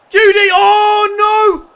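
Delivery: loud, high, desperate yells; real near-miss anguish; frantic shouting. Real, not acted. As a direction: scream at the players to finish it.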